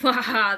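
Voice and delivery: loud mock "waha" laugh; imitated guffaw. Voicing followers laughing at the post, loud mock guffaw. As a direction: burst out a pretend guffaw. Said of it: "you can all be like".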